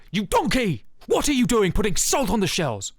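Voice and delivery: high, loud angry celebrity chef yelling; Gordon Ramsay impression. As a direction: bark it like a furious TV chef. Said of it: "You sound like Gordon Ra".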